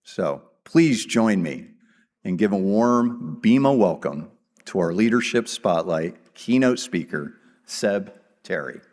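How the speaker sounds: usual speaking voice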